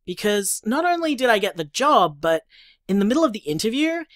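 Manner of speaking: ordinary delivery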